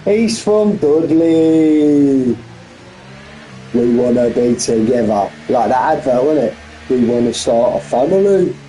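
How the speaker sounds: Birmingham accent. Shown as a demonstration. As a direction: do a playful Birmingham accent like an advert. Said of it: "That's my Birmingham accent for you".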